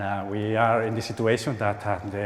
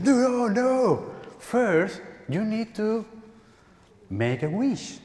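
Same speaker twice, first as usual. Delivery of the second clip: mother voice; 9 semitones higher, slower than usual. Retold or imitated. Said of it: "my sister said".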